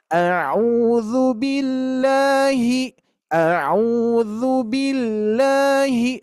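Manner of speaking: slow straight tune, no swing; level recitation. Shown as a demonstration. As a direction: keep "billahi" level and even. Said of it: "Kalau di sini lurus saja". ("billahi" dibaca lurus tanpa ayunan)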